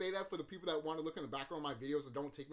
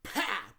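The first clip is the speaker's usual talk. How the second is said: loud imitated punch noise; punch sound effect; 4 semitones higher, 9 dB louder than usual.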